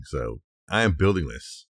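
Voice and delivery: usual speaking voice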